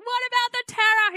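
high, loud hammed-up alarm; mock panic. Wife protesting to her sleeping husband, high mock panic. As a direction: squeak it out in comic panic.

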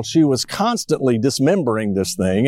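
ordinary talk, nothing marked

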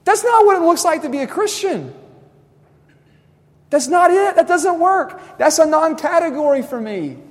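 high, loud exasperated scolding; mock rebuke from Jesus. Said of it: "slap you upside the head and go".

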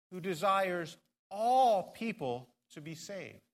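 slow, with all held and pressed; stress on all. Shown as a demonstration. Pastor reading aloud to his church, slowing on all. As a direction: linger on all before people. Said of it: "I'm going to emphasize a certain word".

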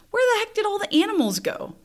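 high-pitched exclamation of bewilderment; retold baffled outburst, pitch jumping way up. Mom finding every barn empty at the fair, baffled.

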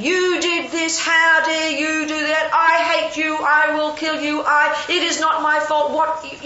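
high, drawn-out monotone tirade; mock angry rant. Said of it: "I'm shouting and yelling".

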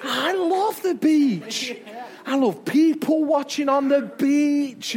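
high, drawn-out delight; big, playful gush of love; gushing enthusiasm. Real, not acted. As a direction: stretch the words with exuberant relish. Gushing about loving the beach.

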